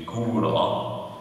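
"gura" said slowly and carefully; slow sample word. Shown as a demonstration. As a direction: spell out "gura" slowly for the class.

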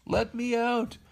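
high-pitched plea at normal volume; calling out. A narrator's reading. Pinocchio from inside the cage, calling out to be freed. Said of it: "called Pinocchio from inside the cage".

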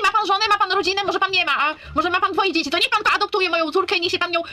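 normal talking voice

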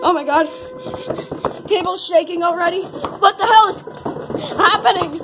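high-pitched rising panic; mock terror building as everything shakes; panicking. Vlogger as the table starts shaking, panicking.

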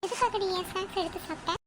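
ordinary talk, nothing marked

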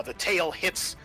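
ordinary talk, nothing marked

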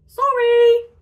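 loud, very high sing-out; high-pitched, exclaimed little bunny; acted apology. A narrator's reading. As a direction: call the apology out high and big. Little Bob apologises to startled bear.

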